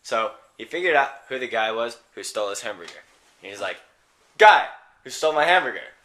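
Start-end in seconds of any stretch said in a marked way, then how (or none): 4.4-6.0 loud, higher hammed-up call; accusing yell. Guy calling out the hamburger thief, accusing yell. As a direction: yell it out like a challenge.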